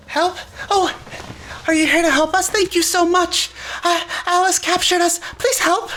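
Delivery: very high, pleading cry for help; shrill frightened captive girl begging; panicked young woman. Acted.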